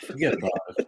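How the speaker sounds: lightly put-on New York accent. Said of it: "slide into my New York accent".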